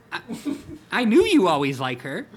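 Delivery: loud, high teasing friend; teasing tiger voice. Acted. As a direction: rib him playfully as Hobbs. Hobbs teases, reader loud.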